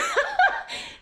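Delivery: very high giggle; high laugh. Real, not acted. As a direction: burst out giggling. Laughing at the memory.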